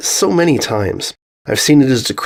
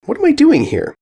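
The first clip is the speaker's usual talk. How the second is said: high, sudden guilty question; guilty alarm; 14 semitones higher than usual. A narrator's reading. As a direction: catch herself slacking off. Scolding herself for truancy.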